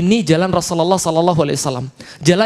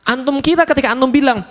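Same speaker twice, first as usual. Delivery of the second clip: usual speaking voice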